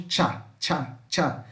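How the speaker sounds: "tchá" with a T before the sh sound. Shown as a demonstration. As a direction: say "tchá" as a sharp ch syllable. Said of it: "É como se tivesse um T".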